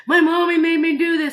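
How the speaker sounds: exaggerated poor-me imitation; mock traumatized. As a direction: wail it like a hammed-up victim. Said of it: "She's not sitting there looking like".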